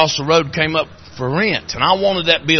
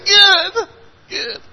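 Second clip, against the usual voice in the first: mock-tearful reply; 4 dB louder than usual. Own reply in retold tearful exchange.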